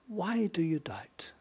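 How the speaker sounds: whispered, slow, to himself. Acted. Preacher asking himself why he doubts: soft whisper. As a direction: breathe the question out. Repeated question, now whispered.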